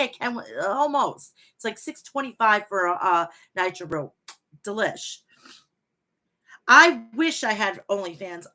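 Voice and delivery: ordinary talk, nothing marked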